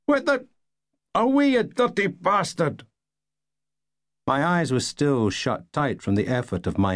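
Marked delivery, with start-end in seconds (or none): 0.1-2.9 a man splattered from above yells; startled yell. Acted. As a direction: bellow it up at the window, furious. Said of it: "there was a yell".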